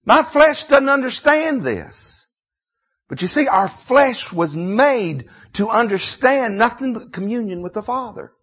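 loud, high Southern pulpit preaching; full-force sermon delivery; impassioned preaching. Real, not acted. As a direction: preach it out high and hard, leaning on key words.

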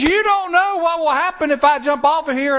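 high-pitched, insistent retort from the jumper; mock holler. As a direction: imitate a defiant man calling down. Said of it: "he hollers back at me".

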